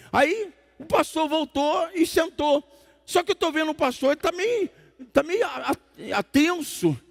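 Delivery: high, animated pulpit storytelling; high-pitched preaching. Acted. (pregação em tom agudo e animado)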